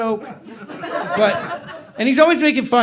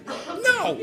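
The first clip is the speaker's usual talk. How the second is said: flat refusal; 11 semitones higher, 4 dB quieter than usual. Retold or imitated. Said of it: "I'm just like".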